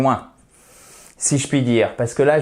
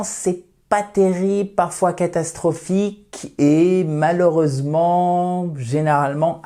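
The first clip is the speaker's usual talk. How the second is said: mock dismay; 5 semitones higher, slower than usual. Juge la prononciation des francophones.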